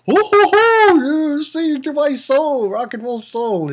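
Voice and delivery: high whooping laugh falling into croon; imitating Brooke. Skeleton musician praises singer, high whooping imitation. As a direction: start very high, gradually easing down as you gush. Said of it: "Brooke was like".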